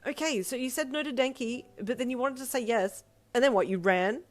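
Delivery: high-pitched, incredulous questioning; accusing. A narrator's reading. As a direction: press the accusation home. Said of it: "her sister asked in an accusing tone".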